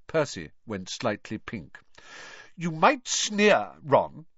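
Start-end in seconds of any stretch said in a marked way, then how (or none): 2.6-4.3 slow, louder, higher retort; heated. A narrator's reading. Older brother defending his report, heated. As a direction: hit back, stung and defensive. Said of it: "he said heatedly".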